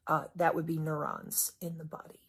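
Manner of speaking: dry whispered aside; a hushed, knowing jab; sarcastic whisper. Acted. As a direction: whisper it like a snide correction. Sarcastic aside about telepathy.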